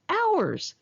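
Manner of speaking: high, exasperated burst of one word; exasperated exclaim. Acted. Recalling hours spent waiting for her friend, exasperated high exclaim. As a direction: throw the word up in disbelief.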